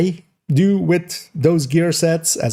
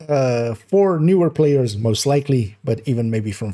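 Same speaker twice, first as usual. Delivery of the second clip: normal talking voice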